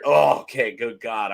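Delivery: ordinary talk, nothing marked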